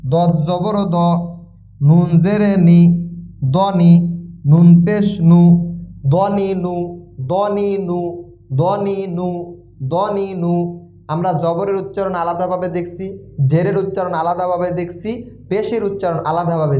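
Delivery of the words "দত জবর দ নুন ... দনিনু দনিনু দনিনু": slow, measured syllable-by-syllable chant; spelling drill recitation. Shown as a demonstration. Teacher modelling Quran spelling for learners, drawn-out drill chant. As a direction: draw out each syllable on a level pitch.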